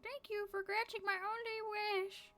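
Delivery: high-pitched dying granny; old lady. Acted. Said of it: "That was supposed to be her, I think".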